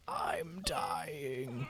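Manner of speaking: breathy whispered dying voice; creepy dying whisper. Retold or imitated. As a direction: rasp it out like you're dying. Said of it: "I forgot you have the creepy, like".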